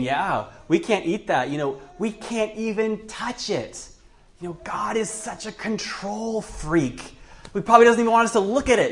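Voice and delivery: acted-out indignant griping, speeding up at the end; griping Eve. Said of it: "it's hard to read the tones".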